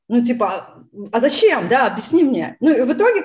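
ordinary talk

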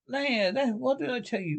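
high, excited exclamation; triumphant. A narrator's reading. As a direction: crow over being proved right. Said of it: "exclaimed the Rat in great triumph".

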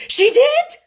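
very high, loud hysterical outburst; piercing, overwrought insistence; hysterical cry. A narrator's reading. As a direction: cry it out wildly, beside yourself. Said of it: "made her hysterical enough".

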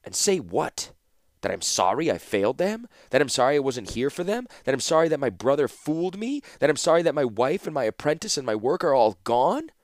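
voice rising. A narrator's reading. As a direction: let the pain climb line by line. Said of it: "Urza's voice rose as he spoke".

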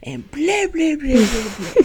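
ordinary talk, nothing marked

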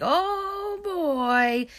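long, high-pitched imitated wail; drawn-out high howl, falling at the end; mock scream. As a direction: imitate a hysterical cry without full volume. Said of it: "He's just, like, screaming".

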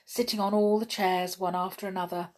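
ordinary delivery, nothing marked

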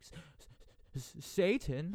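stammering, rising in awe; a mock spooked stammer; awed stammer. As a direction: sound it out haltingly, spooked. Acting out Sarah reading devil's names.

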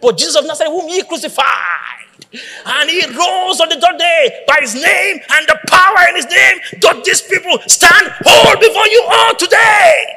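preacher crying out to the crowd; preaching. Acted. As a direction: proclaim it like Peter to the crowd. Apostolic preaching voice.

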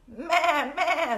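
high goat bleats; goat bleating. Acted. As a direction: bleat like a picture-book goat. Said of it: "said the goat".

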